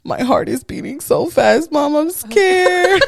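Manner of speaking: high, laughing mock-scared little boy; scared kid. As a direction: play a frightened child, half laughing. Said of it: "And he's like".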